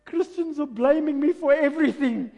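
high, loud, hammed-up wail; exaggerated tearful complaint; mock crying devil. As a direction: wail it as a wronged, sobbing devil. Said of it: "The devil's crying".

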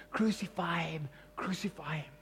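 whispered crucify him; whispered mock crowd chant; hushed crowd cry. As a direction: breathe it like a menacing crowd. Crowd shouting crucify at Jesus.

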